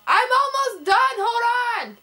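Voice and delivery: high, loud call across the house. Real, not acted. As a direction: yell back that you're nearly done. Calling out to someone offscreen.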